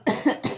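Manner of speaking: short dry cough; coughing fit. Real, not acted. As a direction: cough once, sick. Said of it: "Sorry, I'm coughing a lot".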